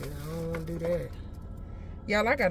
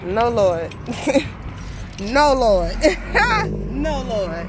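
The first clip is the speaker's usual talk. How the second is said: loud mock dismay; 4 semitones higher, 7 dB louder, slower than usual. Real, not acted. Stranger turns out too big.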